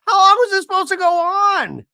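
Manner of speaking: high, loud exaggerated wail; hammed-up shriek of disbelief; mock outcry. [name] interrupts the argument with a hot dog, hammed-up high outcry.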